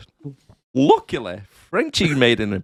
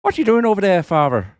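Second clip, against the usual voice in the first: higher Irish priest-show voice; Irish accent; 8 semitones higher, 3 dB louder than usual. Retold or imitated. Father Ted riff, light Irish accent. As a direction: ham up the Irish lilt. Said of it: "Irish accent".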